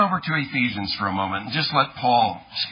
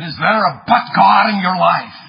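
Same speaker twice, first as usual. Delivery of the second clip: loud, higher, imitated British Welsh preacher; Martin Lloyd-Jones; 5 semitones higher, 8 dB louder than usual. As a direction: ring it out in a mock British Welsh accent. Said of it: "British Welsh accent".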